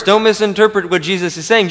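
ordinary talk, nothing marked

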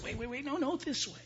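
high, urgent pleading; God pleading with the lost to turn. Acted. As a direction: call them back gently. God pleading with stray person.

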